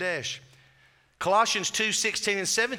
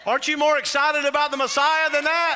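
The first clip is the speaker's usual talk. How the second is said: playful rousing challenge; 8 semitones higher, 6 dB louder than usual. Real, not acted. Preacher teasing congregation for more enthusiasm.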